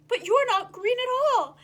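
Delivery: shocked letdown cry; dismayed cry. Acted. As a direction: blurt out disappointment. Disappointed exclamation.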